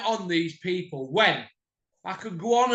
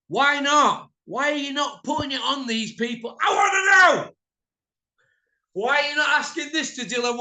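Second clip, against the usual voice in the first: louder and higher, building to a yell; angry shout; 6 semitones higher, 4 dB louder than usual. Real, not acted. Ranting at boxing promoters for dodging questions, angry shout. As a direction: demand it, build to a furious shout.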